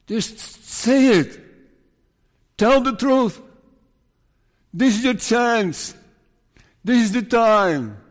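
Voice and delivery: loud, high-pitched impassioned plea; urgent pleading. Acted. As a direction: beg them to tell the truth. Preacher urges Gehazi to confess.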